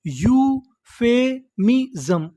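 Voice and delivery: "Euphemism" slowly, with gaps between syllables; broken into syllables. Shown as a demonstration. Teacher showing learners the full word, syllable by syllable. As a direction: sound out "euphemism" piece by piece. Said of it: "टुकड़ों में तोड़-तोड़ के पढ़ना है".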